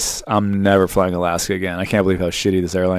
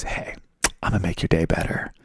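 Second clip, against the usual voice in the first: much softer, a hushed confiding voice; quiet; 4 semitones lower, 9 dB quieter than usual. Retold or imitated. Delta agent quietly doing the customer a favour, in a hushed voice. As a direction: say it softly, just between you two. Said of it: "she, like, quietly goes".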